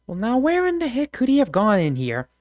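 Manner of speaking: high, puzzled country voice; folksy frontiersman. Acted. Game master voices a searching frontiersman, high folksy puzzlement. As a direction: play a stumped backwoods character. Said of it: "he's just like".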